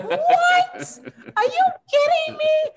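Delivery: high, loud disbelieving yell through a laugh; laughing incredulous shriek. Retold or imitated. Wife retelling the shot day in laughing disbelief. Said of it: "I'm like".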